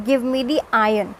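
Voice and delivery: ordinary talk, nothing marked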